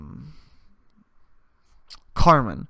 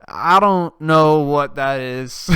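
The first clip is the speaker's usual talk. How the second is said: loud, drawn-out mock disbelief; mock bafflement; 7 dB louder, much slower than usual. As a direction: stretch it out slowly and loudly, imitating bafflement. Mocking the family's baffled refusal.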